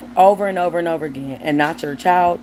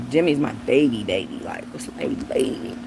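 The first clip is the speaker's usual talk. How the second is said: much lower, softer, fading into a whisper; fond murmur to dog; 8 semitones lower, 4 dB quieter than usual. Real, not acted. Talking fondly to her dog.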